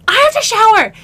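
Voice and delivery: high, loud mock yell; retold panicked outburst. Overwhelmed player announcing her escape to her partner, panicked outburst.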